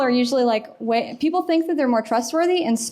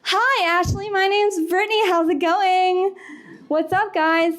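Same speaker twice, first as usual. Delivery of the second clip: put-on perky girl mirror; 8 semitones higher than usual. Shown as a demonstration. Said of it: "mirroring the tone".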